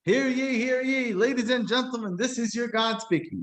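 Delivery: lightly hammed-up heavenly announcer; grand announcement. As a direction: proclaim like a pompous announcer. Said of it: "He did not get up and say".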